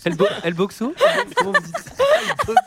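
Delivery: very high, drawn-out mock Spanish, laughing; fake Spanish. Friends joking in terrible fake Spanish, high and laughing. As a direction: stretch "Comment vous dites" high and slow in a silly accent. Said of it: "le mauvais accent français sur les langages étrangers".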